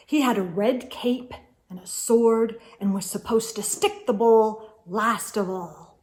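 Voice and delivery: ordinary delivery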